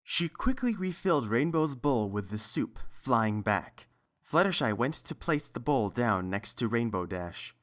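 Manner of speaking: plain reading voice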